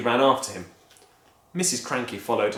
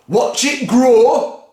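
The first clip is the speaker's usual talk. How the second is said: much higher and louder, slow; excited shout; 12 semitones higher, 12 dB louder, much slower than usual. Acted. Mister Cranky crowing over the hen, loud slow shout. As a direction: crow it out triumphant. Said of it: "shouted Mister Cranky".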